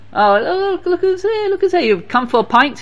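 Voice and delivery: high, sing-out mock surprise; mischievous mocking greeting. Mischievous fellow jeering at visitor.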